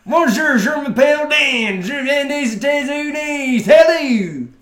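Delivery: high, loud mock American accent in French; moronic American accent. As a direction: caricature a dim-witted American speaking French. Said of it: "on n'a pas l'air débile".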